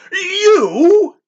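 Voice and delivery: very high, loud startled bellow. A narrator's reading. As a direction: bellow in disbelief. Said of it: "he bellowed".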